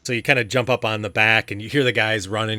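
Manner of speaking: ordinary delivery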